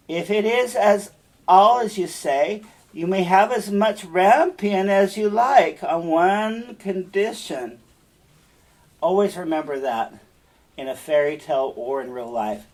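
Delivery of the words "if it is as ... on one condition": higher, louder, slow scheming witch. Acted. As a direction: offer the deal like a sly witch. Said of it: "Then the witch said".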